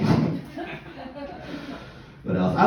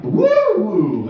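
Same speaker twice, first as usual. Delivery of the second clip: cheering woo; 10 semitones higher, 6 dB louder than usual. Retold or imitated. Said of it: "so you're like".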